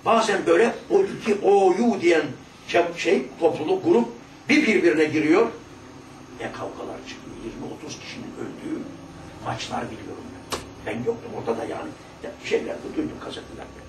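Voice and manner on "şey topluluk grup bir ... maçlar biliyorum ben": normal talking voice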